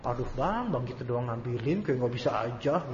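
soft, low mock complaint; imitated grumbling. Wife brushing off her husband's request, mildly grumbling. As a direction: mimic a wife's reluctant grumble under her voice.

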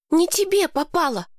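put-on a girl cutting in to correct him. A narrator's reading. Lowie correcting Mason, high indignant girl voice. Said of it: "поправила его Лоуви".

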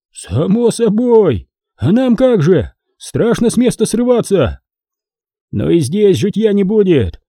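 high-pitched peasant complaint; fretful debate, pitched well up; worried villagers. A narrator's reading. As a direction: grumble over hard times. Villagers argue about leaving.

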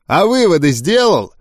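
sharp question. A narrator's reading. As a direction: toss it out with a little edge. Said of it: "резко бросил Гоблин". (резковато, с вызовом)

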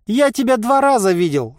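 loud, high-pitched merchant; heated high retort; unabashed retort. A narrator's reading. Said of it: "не смутился Харчик".

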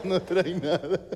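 doctor chuckling out a brush-off; laughing dismissal. Retold or imitated. Doctor laughs off the comedian's chest pain, chuckling. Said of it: "Riéndose el hijo de puta".

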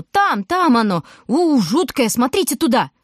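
shouting feigned alarm at the crowd; calling out. A narrator's reading. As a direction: point the crowd away, loudly. Grocer misdirects the crowd.

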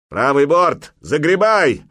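high, loud command; barked order. A narrator's reading. Helmsman orders the oarsmen to turn, barking. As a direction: bellow it to the rowers. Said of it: "гаркнул он".

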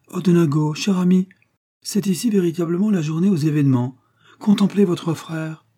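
voix bien plus aiguë et forte; s'extasier avec théâtralité; exclamation emphatique. A narrator's reading. Mrs O'Donaghue exclaims to husband. (la mariée annonce le frère à son mari, très aiguë)

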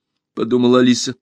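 ordinary delivery, nothing marked

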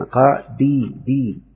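قا بي بي broken into slow separate syllables; showing the kasra. Shown as a demonstration. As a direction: draw out the i in بي, repeating it. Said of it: "يعني الحرف الثاني مكسور".

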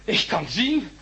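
overjoyed at seeing again; joyful discovery. Acted. Bandage off, he can see.

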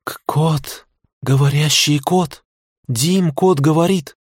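slow, halting, rising disbelief; stunned disbelief. Acted. Tanya stammering to Dima about the talking cat, stunned. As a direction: stammer it out, unable to believe.

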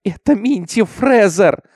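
high, loud, drawn-out accusation; sobbing scream. A narrator's reading. Hysterical girl accuses Minty, high loud cry. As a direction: cry it out slowly and shrilly as a child. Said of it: "закричала она, рыдая".

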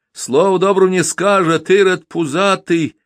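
high wailing housewife's lament; woman scolding a drunk, high lament. A narrator's reading. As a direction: wail at the drunk as she retreats. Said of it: "запричитала Августа".

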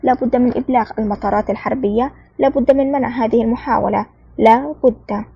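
ordinary delivery

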